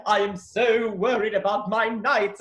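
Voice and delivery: high, slow mock-dramatic declaiming; hammed-up solemn period drama; overly serious. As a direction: play a dog acting King Arthur in earnest. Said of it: "he was always so serious".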